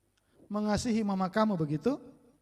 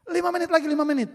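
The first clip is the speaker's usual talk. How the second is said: put-on stalling kid; 10 semitones higher, 7 dB louder, faster than usual. Child stalling mother.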